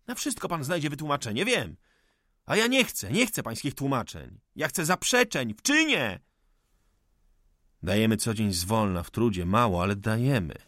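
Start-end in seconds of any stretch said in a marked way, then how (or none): 0.1-6.2 raised high, passionate accusation; heated outburst. Acted. Cezary rails at the old forester, high and furious. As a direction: hurl it at him like an indictment. Young man rejects explanations.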